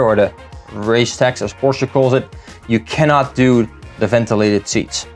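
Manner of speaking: ordinary talk, nothing marked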